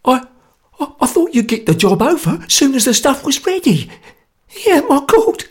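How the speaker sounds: high, loud stammering panic; a scared man losing his nerve; terrified stammer. Acted. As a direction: stumble over words in fear.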